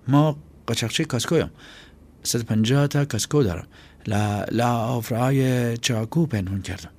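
put-on high, hesitant boy's voice bluffing; a scared kid trying to sound tough; nervous boy. Boy Chaghak bluffing to Aqrab.

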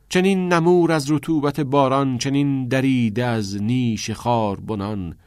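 anguished stage verse, opening high and strong, then easing; theatrical verse recital. Acted. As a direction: declaim a weary lament from a play.

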